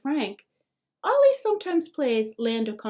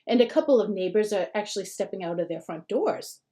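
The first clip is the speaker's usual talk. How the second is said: ordinary delivery, nothing marked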